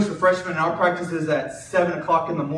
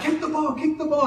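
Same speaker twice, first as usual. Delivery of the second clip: retold yelling; 10 semitones higher than usual. Coach yelling to his kicker from the sideline, mock yell. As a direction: call it out urgently, higher than usual. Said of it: "and I'm yelling".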